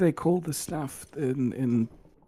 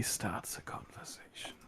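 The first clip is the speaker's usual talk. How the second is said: breathy whisper; 8 semitones lower, 15 dB quieter than usual. Real, not acted. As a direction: whisper it under your breath. Whispered jab at quiet diners.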